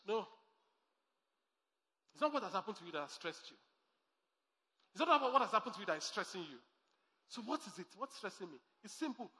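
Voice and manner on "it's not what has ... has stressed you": same sentence rattled off fast and soft; fast, low-key restatement; quick repeat. Shown as a demonstration. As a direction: toss the line off quickly.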